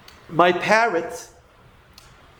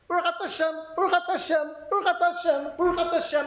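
higher, mechanical parrot-like repetition; mindless parrot; 5 semitones higher, faster than usual. Acted. Teacher mocking blessings said without thinking, in parrot-like rote. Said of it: "you sound like a parrot?".

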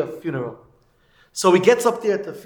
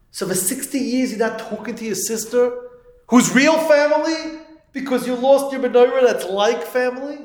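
acted incredulous reproach; 7 semitones higher than usual. Rabbi to man estranged over a menorah, acted incredulous reproach. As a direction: confront him in rising disbelief.

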